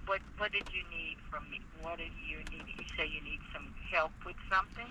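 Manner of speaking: ordinary delivery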